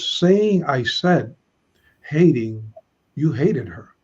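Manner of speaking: slow word-by-word "Saying I said, hating"; teacher giving a literal translation of the verb doubling, slow; literal rendering. Shown as a demonstration. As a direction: spell out the Hebrew doubling slowly. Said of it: "one can say that he says".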